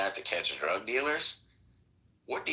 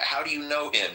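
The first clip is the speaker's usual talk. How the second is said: retold screaming; 8 semitones higher than usual. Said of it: "they're screaming at him".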